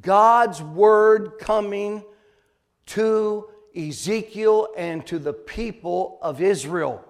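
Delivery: forceful, loud sermon delivery; preach. Acted. Preacher declaring God's word to the congregation, forceful preaching. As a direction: preach it out with hard, loud emphasis on key words.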